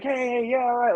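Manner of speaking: high, forced breezy hey hey; mock-cheery cover-up after a bad show; fake cheerful front. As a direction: put on a fake happy face for the crowd. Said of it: "put the fa".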